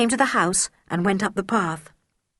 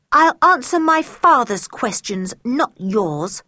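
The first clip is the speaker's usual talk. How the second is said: defiant; 5 semitones higher, 5 dB louder, slower than usual. Acted. George defying the tutor.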